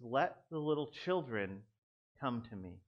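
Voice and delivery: slow, restrained reproof, dropping low at the end; mildly upset, measured and slow; a bit of a tone. Acted. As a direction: say it quietly with a slight edge. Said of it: "had a little bit of a tone here".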